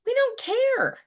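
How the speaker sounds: high, flat-out dismissive; mock indifference. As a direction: toss it off like nobody cares. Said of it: "they're like".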